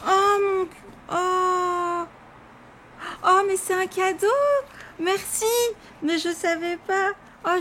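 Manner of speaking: real high-pitched, emotional gasping "oh"; touched surprise. Real, not acted. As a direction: discover an unexpected gift, voice soaring. Realises it is a surprise gift.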